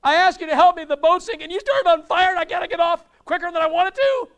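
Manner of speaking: high, loud frantic yell; screaming at God. Retold or imitated. Drowning man yells up at God in disbelief. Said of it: "he starts screaming out to God".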